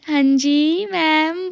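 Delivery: lilting, affectionate mimicry; cute, sweet. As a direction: stretch it out sweetly, imitating her. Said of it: "ये देखो कितनी cute है".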